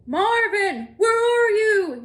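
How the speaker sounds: high, loud, slow call; yelling. Acted. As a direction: yell it out for the lost moose. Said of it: "yelled the zookeeper".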